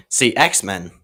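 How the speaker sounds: usual speaking voice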